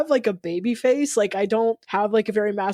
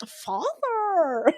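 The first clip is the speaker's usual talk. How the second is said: high, drawn-out mock "Father"; Pinocchio voice; 9 semitones higher than usual. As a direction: stretch it out pitched up, hammy. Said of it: "the gayest ass person is just like".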